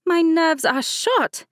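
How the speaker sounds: high, slow, whiny complaint; complaining bride. A narrator's reading. Nervous bride complains to her cousin before the wedding. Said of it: "Mercedes complained".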